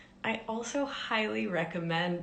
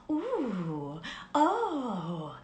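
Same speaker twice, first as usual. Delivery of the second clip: cooing noises; 4 semitones higher than usual. Shown as a demonstration. Parent coos to a newborn, tender rising coo. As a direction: coo like to a baby. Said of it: "We'll make those noises".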